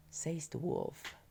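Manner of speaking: ordinary delivery, nothing marked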